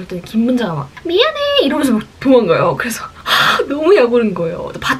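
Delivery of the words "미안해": very high, squeaky mock apology; fleeing apology. Friend runs away from angry girl, high-pitched apology. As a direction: toss off a high-pitched sorry, imitating her. 친구가 사과하며 도망감.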